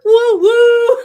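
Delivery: high, loud celebratory woo-hoo; playful whoop. Real, not acted. Guest cheering about turning sixty this year, playful whoop. Cheering own sixtieth birthday.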